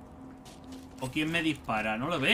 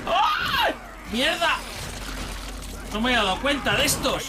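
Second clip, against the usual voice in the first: panicking gamer; 8 semitones higher than usual. Real, not acted. Ambushed by enemies in game.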